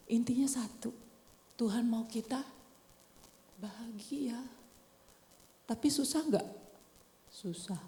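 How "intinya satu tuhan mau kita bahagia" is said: hushed unvoiced whisper to the congregation. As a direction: lean in and whisper it. Preacher whispers key point. (berbisik pelan)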